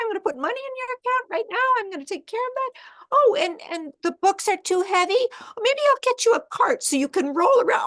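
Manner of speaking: mother rushing to rescue her daughter, shrill and fast; frantic rescuing mother. Acted. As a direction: gush over her with frantic help. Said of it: "mother goes to rescue her and goes".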